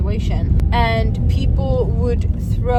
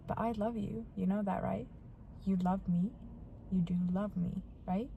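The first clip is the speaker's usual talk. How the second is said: very soft, lower acted coaxing; hushed, insistent pleading; 4 semitones lower, 18 dB quieter, faster than usual. Vanessa coaxing her crying partner, hushed and insistent. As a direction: imitate her pressing for agreement, barely raised above a murmur.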